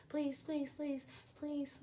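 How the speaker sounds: high, soft dismissive pleases; dismissing the ex with a high, sarcastic please; mock pleading. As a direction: brush him off with a high mock plea.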